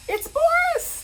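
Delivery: high, loud joyful cry; excited shout. Retold or imitated. Boris recognizes Kevin at the meeting, a high joyful shout. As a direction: shout it like you've found a long-lost friend.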